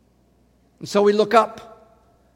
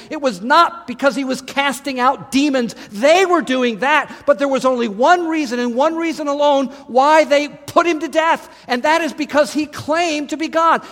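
raised, rising preaching voice; fervent preaching; 6 semitones higher than usual. Acted. Preacher proclaiming the miracles to the congregation, fervent. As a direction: build the fire of a sermon.

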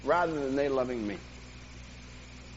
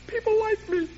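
mock popularity. Mock cheer at his popularity.

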